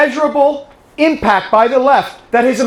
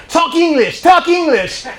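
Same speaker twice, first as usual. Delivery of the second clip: high-pitched mimicked playground bully; mock bullying demand; 9 semitones higher than usual. Girl confronting a picked-on British kid, mock bullying demand. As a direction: imitate a bossy girl, pitched way up.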